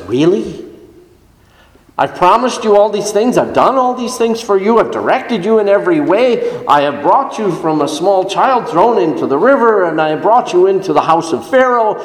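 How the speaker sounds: incredulous, high-pitched rebuke piling up grievances; angry God. Acted. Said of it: "The anger of the Lord burned against Moses".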